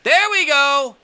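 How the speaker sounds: high, loud triumphant cheer; excited cheer. Real, not acted. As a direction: celebrate the hit. Perfect hit in the game.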